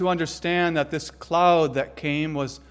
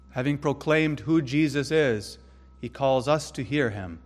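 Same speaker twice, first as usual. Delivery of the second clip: normal talking voice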